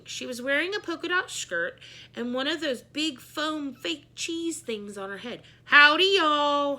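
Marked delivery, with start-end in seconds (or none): 5.6-6.8 loud, high Texas howdy; Texan greeting. A narrator's reading. Miss Yonkers greets the class, loud Texan drawl. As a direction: holler it like a Texan. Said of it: "she said".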